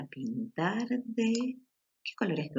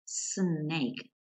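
ordinary talk

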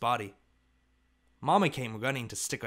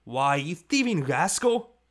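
louder, slow, higher angry cry; slow, loud scolding yell; angry shout; 4 semitones higher, 5 dB louder, slower than usual. A narrator's reading. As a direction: shout it at the thieving dog. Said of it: "I shouted".

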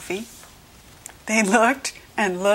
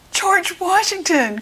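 high, thrilled shout of recognition; storybook cry of discovery; excited cry; 11 semitones higher, 3 dB louder than usual. A narrator's reading. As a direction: cry the name out, pitched way up. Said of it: "cried Sam".

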